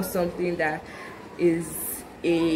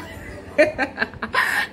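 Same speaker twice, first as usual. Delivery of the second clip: breathy high giggle after a song; giggling laugh; 7 semitones higher than usual. Real, not acted. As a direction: laugh at your own silly song. Laughs after finishing her song.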